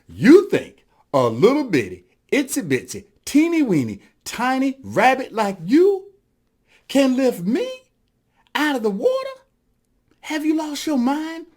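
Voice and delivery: mocking disbelief. Whale to tiny boasting rabbit, mocking disbelief. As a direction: pile up each phrase in rising disbelief.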